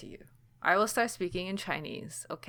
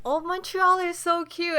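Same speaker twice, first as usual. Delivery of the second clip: higher, slower, gushing mock voice; sing-song mock of friends raving about Montreal; mocking gushing tourist; 11 semitones higher, 4 dB louder than usual. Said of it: "And they're like".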